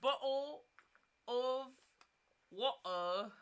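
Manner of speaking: word-by-word mock British accent; exaggerated British, a pause after each word. Savouring a viewer's joke, deliberate mock British accent. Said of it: "Tuesday British accent".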